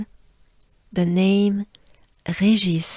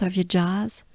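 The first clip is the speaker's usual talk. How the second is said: ordinary talk, nothing marked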